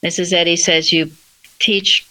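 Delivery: normal talking voice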